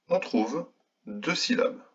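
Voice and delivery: normal talking voice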